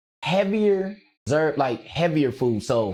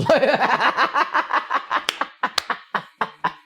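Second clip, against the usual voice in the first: hyena laugh; 8 semitones higher than usual. Real, not acted. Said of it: "Lord, that's how I sounded".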